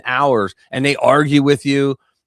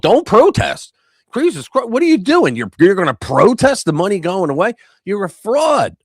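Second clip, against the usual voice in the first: mock scolding; 8 semitones higher, 5 dB louder than usual. Retold scolding of protesters.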